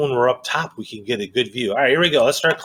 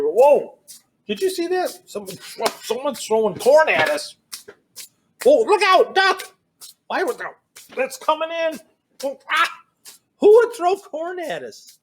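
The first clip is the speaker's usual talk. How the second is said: much higher, startled and playful; mock alarm; 12 semitones higher than usual. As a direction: squeal like corn is flying at you. Pretend corn thrown at them.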